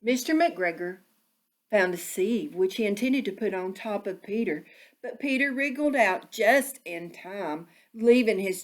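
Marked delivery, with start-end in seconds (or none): none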